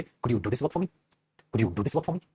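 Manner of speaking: soft, low, courteous request; polite request. Shown as a demonstration. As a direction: ask sweetly, as a favour. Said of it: "तमीज और तहजीब के साथ पूछना होगा".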